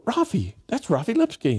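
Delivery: high, startled cry of recognition; surprised recognition. Retold or imitated. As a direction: blurt out the name in shock. Nieces recognize the hidden boy.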